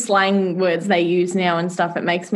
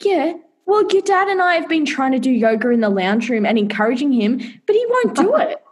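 much higher, sing-song mum; mock mum; 4 semitones higher than usual. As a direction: chirp it like an overly hopeful mum. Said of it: "she's like".

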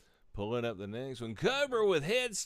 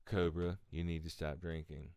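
mock intervention voice; 9 semitones lower, 5 dB quieter than usual. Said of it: "they had an intervention".

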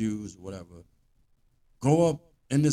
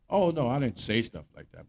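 ordinary talk

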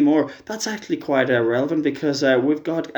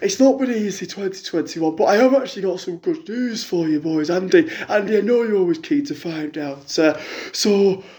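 a lightly put-on middle-aged fan on the phone; a fan phoning in with a sob story, lightly voiced; Jackie caller voice; 4 semitones higher than usual. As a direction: play the hard-up caller, chatty and woeful. Jackie tells her news.

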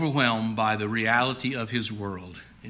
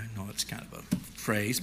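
normal talking voice

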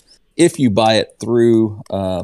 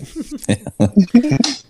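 high-pitched laugh; real amused laugh, pitched high. Real, not acted. Laughing after agreeing on mispronunciation.

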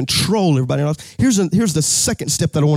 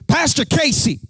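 mock formal call; 9 semitones higher than usual. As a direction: call out to get his attention. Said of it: "She went".

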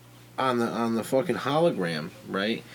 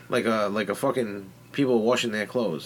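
usual speaking voice

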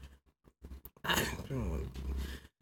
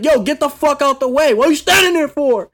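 retold shouting, louder and higher; mock angry yell; 8 semitones higher, 6 dB louder than usual. Guy on the train yelling at him to move, mock angry. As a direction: bark it like a guy in your face. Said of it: "and he's like".

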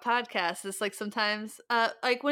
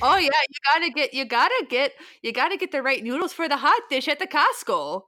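raised, loud Minnesota caricature; Minnesota accent; 5 semitones higher, 7 dB louder than usual. Acted. Minnesotan advises on noodles for hot dish, broad Minnesota accent. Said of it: "insulting accent for Minnesotans".

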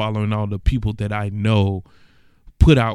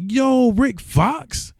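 freaking out; 13 semitones higher than usual. Retold or imitated. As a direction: plead in shocked disbelief at the news. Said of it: "I'm freaking out".

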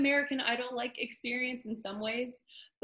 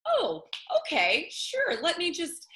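taken-aback agreement; 9 semitones higher, 4 dB louder, slower than usual. Retold or imitated. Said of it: "And it's like".

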